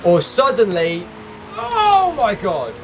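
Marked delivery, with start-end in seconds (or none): 1.6-2.7 mock alarm. Car about to spin.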